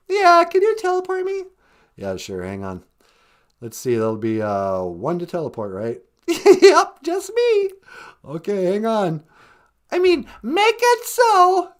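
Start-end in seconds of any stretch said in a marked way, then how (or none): 2.0-6.0 much lower put-on grandma voice; low, playful old-lady voice answering from upstairs; Nana character voice. 8.2-9.2 much lower put-on grandma voice; low, playful old-lady voice answering from upstairs; Nana character voice. Nana voice continues.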